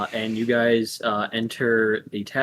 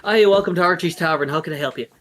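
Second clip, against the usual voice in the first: louder, fast, bright welcome; cheerful waitress; 4 semitones higher, 5 dB louder, faster than usual. Acted. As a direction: greet the guests like a chipper server. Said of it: "and just goes".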